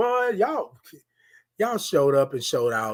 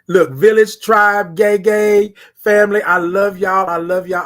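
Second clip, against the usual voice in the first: excited shout-out; 4 semitones higher, 9 dB louder than usual. Real, not acted. Host hyping up his live viewers, excited and loud. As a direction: call out to your fans with joy. Hyped shout-out to stream family.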